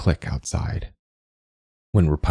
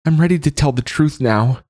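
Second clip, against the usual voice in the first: high, louder feigned surrender; calling out; 11 semitones higher, 4 dB louder than usual. Acted. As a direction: call up, faking contrition. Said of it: "she calls".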